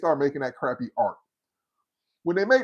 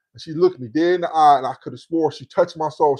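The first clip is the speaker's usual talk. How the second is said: ordinary talk, nothing marked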